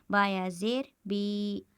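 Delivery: slow, stretch बी for a second or two; slow spelled-out recitation. Shown as a demonstration. Teacher demonstrating बी to students, stretched slowly. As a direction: spell बा या ज़ेर बी slowly, holding the ई of बी for one alif's length. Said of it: "एक अलिफ के बराबर खींचकर पढ़ेंगे".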